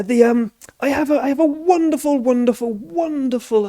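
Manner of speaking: high, gushing farmer voice; a proud farmer praising his donkey; Balbo Bunce. Acted. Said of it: "my name is Balbo Bunce".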